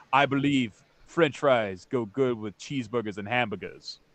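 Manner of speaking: put-on Bahamian accent. Caped man answering the king's question, Bahamian accent. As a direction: quote him in a Bahamian accent. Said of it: "I like the Bahamian accent you threw in there".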